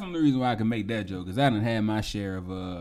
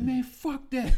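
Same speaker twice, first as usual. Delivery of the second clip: higher, defiant retort; 12 semitones higher, much slower than usual. Retold or imitated. Angry friend refusing to calm down, defiant. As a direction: brush off the warning. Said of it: "I'm like".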